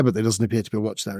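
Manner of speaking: normal talking voice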